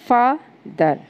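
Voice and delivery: Father broken as फा, दर with both beats stressed; two-beat syllable split. Shown as a demonstration. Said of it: "father बोलने में दो बार मात्रा लगानी पड़ी".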